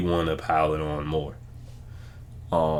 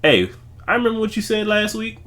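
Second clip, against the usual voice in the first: imitating a coach, higher, pointed; pointed callout; 11 semitones higher than usual. Imitating Coach Prime calling out a reporter, pointed. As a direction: needle the reporter with a pointed reminder. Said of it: "he call you out".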